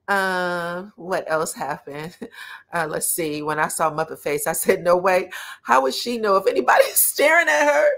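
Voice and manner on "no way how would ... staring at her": loud, high, laughing incredulity; amused disbelief. Retold or imitated. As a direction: retell it with a laugh breaking in. Retelling own amused disbelief at moment.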